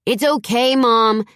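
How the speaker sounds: put-on high, loud boy's yell from upstairs. A narrator's reading. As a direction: shout it down the stairs to mom. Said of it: "Zach yelled down".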